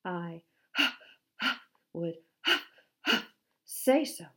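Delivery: heavy panting between words; out of breath from the climb; gasping. A narrator's reading. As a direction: wheeze it out, badly winded. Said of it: "gasped Handsome".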